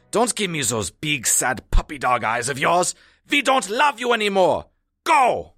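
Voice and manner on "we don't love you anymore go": loud, high cry of rejection; anguished send-off. Acted. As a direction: shout it to drive away a loved dog. Sending the dog away.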